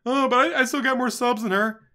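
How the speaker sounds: high, loud mocking whine; mocking whiny voice. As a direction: sneer it like a sore loser. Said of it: "How pissed he'd be".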